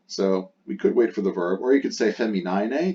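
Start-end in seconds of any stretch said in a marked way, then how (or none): none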